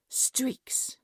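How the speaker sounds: a sharp, breathy hiss of one word; disdainful hissed whisper; hissed disgust. Acted. Queen scolds servant over mirror.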